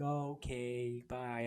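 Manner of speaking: slow, soft little Clippy voice, a touch hurt; Clippy's voice. Pretend. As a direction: voice the paperclip assistant slowly and softly, lightly hurt. Clippy replies, voiced by speaker.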